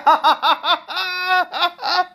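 high, loud peals of laughter; real helpless laughing fit; uncontrolled laughter. Real, not acted. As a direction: burst out laughing hard. Cracking up at own impression.

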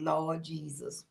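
low, soft, slow Lord Jesus; weary lament. Real, not acted. Host reacting to the game controller, low weary sigh. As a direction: shake your head at it.

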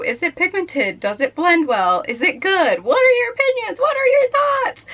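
mock clamoring fans, rising to a high squeal; eager audience questions. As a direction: build from quick questions to a very high-pitched plea. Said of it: "what everyone really wants to know".

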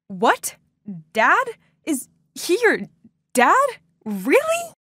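can't believe what you're hearing; a stunned, rising "Really?"; shocked disbelief. Acted. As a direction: voice shooting up high in disbelief. Shock that Linda's dad.

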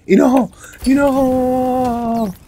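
high slow drawn-out squeal; long high teasing cry; excited. Real, not acted. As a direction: draw it out gleefully. Spotting enemy again.

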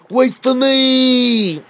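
high, drawn-out call; calling toy voice. Acted. Small toy calls after friends, slow high cry. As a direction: stretch the call long and high.

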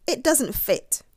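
ordinary delivery, nothing marked

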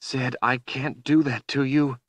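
high, distressed protest; horrified. A narrator's reading. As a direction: plead in horror, pitched high. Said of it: "Kalen was horrified".

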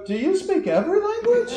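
high-pitched mock astonishment; amazed. German diners marvel at her multilingual father, amazed. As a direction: quote them in incredulous, soaring pitch.

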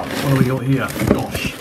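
usual speaking voice

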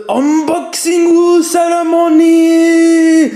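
slow, loud ceremonial announcement; announcer shout. Acted. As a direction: proclaim it like a ceremony. Announcing the unboxing.

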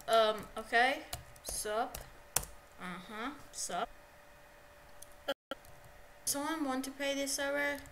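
ordinary talk

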